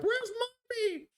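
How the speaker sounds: very high, drawn-out little cub voice; high-pitched pleading cub; bear cub. Acted. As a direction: squeal it slowly like a baby bear missing its mother. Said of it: "They're always asking".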